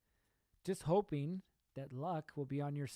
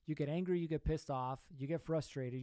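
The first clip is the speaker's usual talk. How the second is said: usual speaking voice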